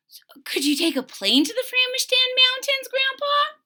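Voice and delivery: put-on high, whispery little kid asking; breathy high child's plea. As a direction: ask grandpa like a small child. Child character asks grandpa.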